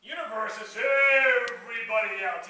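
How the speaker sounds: EVERYBODY stretched long and loud; slow, loud swell on "everybody"; drawn-out emphasis. Real, not acted. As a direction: hold the first vowel of "everybody" very long and loud. Stretching out how vast it is.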